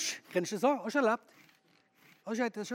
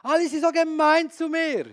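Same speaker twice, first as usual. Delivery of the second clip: higher, louder mock whining; whiny self-pity; 7 semitones higher, 5 dB louder than usual. Preacher mocking his own complaint to Jesus, higher whiny voice. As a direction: whine like a sorry victim. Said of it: "Ich sage".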